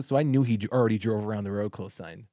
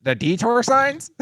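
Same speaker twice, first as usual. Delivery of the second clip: mock puzzled driver; 11 semitones higher, 3 dB louder, slower than usual. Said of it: "he's like".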